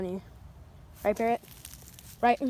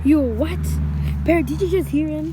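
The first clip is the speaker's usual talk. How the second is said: shocked outburst; 5 semitones higher, 6 dB louder than usual. Pretend. Toy character shocked at confession.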